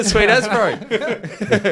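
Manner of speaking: laughing. Retold or imitated. Echoing Kiwi line laughing.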